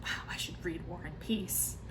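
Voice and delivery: breathless little whisper of awe; whispered thought. Retold or imitated. Recalling everyone reading the book, whispered wonder. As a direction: hush it like a secret wish. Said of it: "And I was like".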